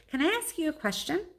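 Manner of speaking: put-on high girl's voice; Julia asking. Julia asking her dad a homework question, high and sweet. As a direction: ask sweetly as a young girl. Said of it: "Julia says".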